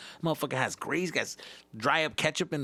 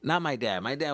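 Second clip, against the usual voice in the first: ordinary delivery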